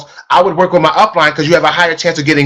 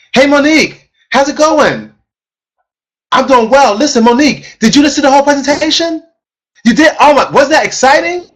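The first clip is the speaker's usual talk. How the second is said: smiling energetic voice. Shown as a demonstration. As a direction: smile hard and let it carry through the phone. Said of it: "I sound louder. I sound stronger".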